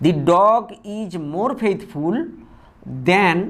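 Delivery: each word spaced out for copying; slow dictation. Shown as a demonstration. Teacher dictating an example sentence to students, slow and deliberate. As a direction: read it out slowly for students to write.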